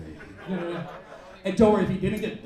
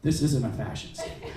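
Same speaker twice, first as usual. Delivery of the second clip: ordinary talk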